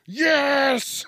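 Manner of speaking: loud, high shouted yes; shouting. Pretend. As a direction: yell it at the machine. Yelling answer at automated machine.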